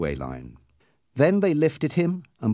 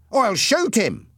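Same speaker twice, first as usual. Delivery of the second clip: high-pitched, eager threat; mildly angry, raised pitch; 9 semitones higher, 4 dB louder, slower than usual. A narrator's reading. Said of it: "Ernie asked angrily".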